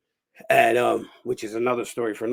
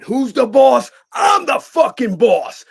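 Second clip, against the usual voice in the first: loud, high-pitched angry shout; furious boss; 12 semitones higher, 10 dB louder, slower than usual. Retold or imitated. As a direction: assert who's in charge, shouting it out. Acts out his angry outburst.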